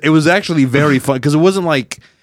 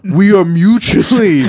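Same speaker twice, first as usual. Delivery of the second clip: mock dramatic; 8 semitones higher, 6 dB louder, slower than usual.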